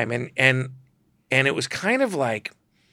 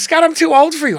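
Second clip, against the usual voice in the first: much higher, louder brassy diva; Joan Rivers; 10 dB louder than usual. Retold or imitated. Joan brushing off the photographer's flirting, brassy mock coyness. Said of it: "and she goes".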